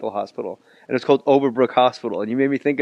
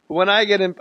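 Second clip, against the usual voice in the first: ordinary talk, nothing marked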